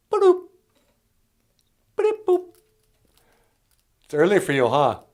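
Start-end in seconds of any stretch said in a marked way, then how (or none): 0.1-2.5 high electronic boops and beeps; computer beeps. Pretend. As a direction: beep like a talking machine. Computer beeping.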